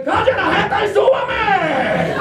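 high, drawn-out comic yell; impatient outburst. Acted. Envoy ordering the boy to stop explaining, impatient outburst. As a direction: cut him off with a high, impatient holler.

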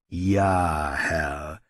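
low, drawn-out assent; placating henchman. Acted. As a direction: humour the master in a low voice. Henchman humours the ruler. (tief und beschwichtigend)

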